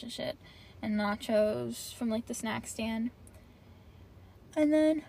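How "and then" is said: and then, cut off by a yawn. Real, not acted. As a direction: yawn breaking into the words. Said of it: "sorry, I'm yawning".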